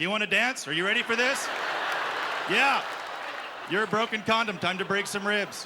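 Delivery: put-on squaring up to a toddler in jest; mock fight challenge.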